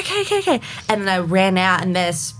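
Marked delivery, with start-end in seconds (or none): 0.0-0.6 high-pitched flustered reply; mock flustered. Latecomer answering her impatient friends, mock flustered high voice. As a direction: imitate a latecomer giving in, high and rushed.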